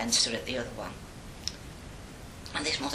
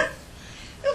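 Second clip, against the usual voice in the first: ordinary talk, nothing marked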